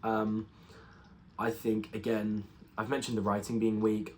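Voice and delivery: ordinary talk, nothing marked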